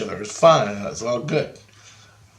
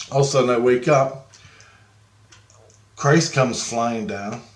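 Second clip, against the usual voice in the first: ordinary talk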